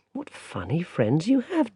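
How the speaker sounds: slow, gentle grandmother voice; an old granny asking kindly. Acted. Grandmother asks Barney where he's been, slow gentle granny voice.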